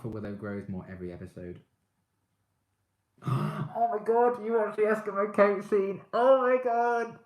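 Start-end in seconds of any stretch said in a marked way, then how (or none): 3.2-3.7 sharp high gasp of delight; excited gasp. Real, not acted. Fangirling over Willow scene. 3.8-7.2 very high, loud excited squeal; fangirl squealing. Real, not acted. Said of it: "Willow fangasm over".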